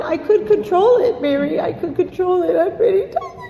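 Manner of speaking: very high, wailing mock sobs; hysterical crying. Newcomer insisting to her sponsor she could control it, hysterical. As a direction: act out a hysterical, tearful protest.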